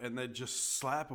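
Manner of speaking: ordinary delivery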